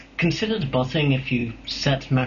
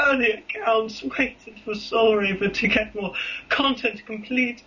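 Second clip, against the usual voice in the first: high, slow mock-pitiful whine; sad voice; 8 semitones higher than usual. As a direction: whimper it like a hammed-up sob story. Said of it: "I think I'll use my sad voice".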